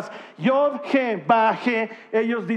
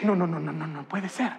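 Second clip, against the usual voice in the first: soft wonder; 4 semitones lower, 6 dB quieter than usual. Real, not acted. Softly marvelling denial.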